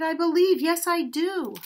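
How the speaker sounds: bursting out. Acted. Donkey bursting out his faith to the man, loud and joyful. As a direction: blurt it out, overjoyed. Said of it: "The donkey burst out".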